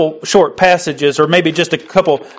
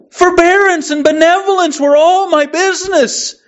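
high, anguished ghost; unearthly voice; 7 semitones higher than usual. Acted. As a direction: plead it like a tormented spirit. Ghost's speech, high pitched.

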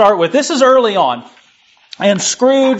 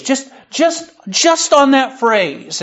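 normal talking voice

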